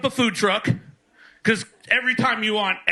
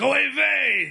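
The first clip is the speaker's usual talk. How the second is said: higher-pitched comic exclamation; mock lament; 6 semitones higher, slower than usual. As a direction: wail it jokingly as a stock Yiddish lament. Mock exclamation in a stand-up bit.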